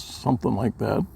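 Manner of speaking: usual speaking voice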